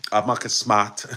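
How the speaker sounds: Marcus Smart in a Boston accent, r's dropped; playful Boston accent, Mahkus Smaht. Shown as a demonstration. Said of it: "my Boston accent".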